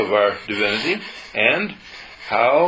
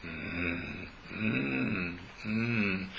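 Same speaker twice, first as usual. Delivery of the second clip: soft soothing hum; 3 semitones lower, 11 dB quieter, much slower than usual. Shown as a demonstration. Wordless soothing hum to dog.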